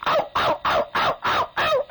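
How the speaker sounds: little dog yelps, unvoiced; breathy dog yapping; yapping corgi. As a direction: yip like a tiny corgi. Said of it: "and he goes".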